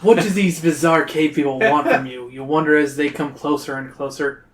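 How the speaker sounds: ordinary delivery, nothing marked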